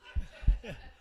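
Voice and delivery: unvoiced laugh; breathy laugh. Real, not acted. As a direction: laugh under your breath. Laughs at own story.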